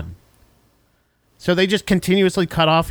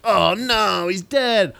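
mock dismay; 6 semitones higher, much slower than usual. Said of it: "And they're like".

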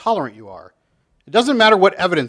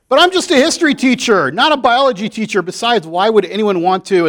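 higher, louder protesting teacher; pleading teacher; 5 semitones higher, 5 dB louder than usual. Acted. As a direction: defend yourself to the class, exasperated. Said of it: "back at the Bible Belt Elementary School".

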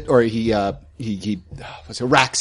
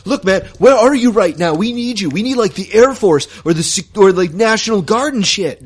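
urgent plea; 7 semitones higher, 3 dB louder than usual. Acted. Pleading for help over radio.

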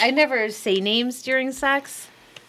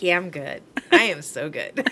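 mock smug husband; 6 semitones lower, 6 dB quieter than usual. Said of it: "Tom's like".

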